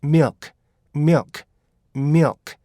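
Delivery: milk run together as one syllable, short vowel, clear final k. Shown as a demonstration. Teacher models how milk is pronounced, joined-up pronunciation. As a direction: say "milk" as a quick "myuk" with a crisp k. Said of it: "이렇게 발음이 되는 거죠".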